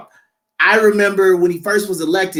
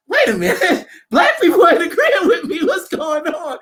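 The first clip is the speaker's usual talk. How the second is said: higher, a laugh breaking in, sudden realization; laughing surprise; 9 semitones higher than usual. Retold or imitated. Host noticing who cheers him, laughing surprise. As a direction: laugh it out as the penny drops.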